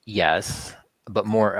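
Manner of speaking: usual speaking voice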